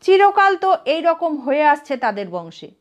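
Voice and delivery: ordinary delivery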